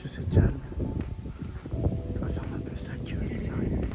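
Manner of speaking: soft unvoiced whisper. Real, not acted. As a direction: whisper it under your breath. Whispering in quiet scene.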